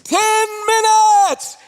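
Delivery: long, high, drawn-out shouted time call; instructor's shout. Retold or imitated. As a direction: holler it out, stretching each word. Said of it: "they come back in and they say".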